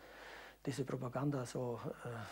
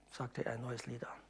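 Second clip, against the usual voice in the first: ordinary talk, nothing marked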